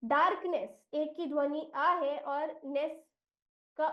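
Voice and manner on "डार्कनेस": डार्कनेस said as daark-ness with a long aa; teacher modelling डार्कनेस; model pronunciation. Shown as a demonstration. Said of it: "इस शब्द का उच्चारण हम करेंगे".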